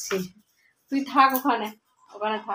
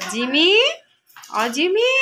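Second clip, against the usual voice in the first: high, louder call to the pet; calling Jimi over, high and loud; calling the dog; 8 semitones higher, 8 dB louder, slower than usual. Real, not acted. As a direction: sing out the dog's name.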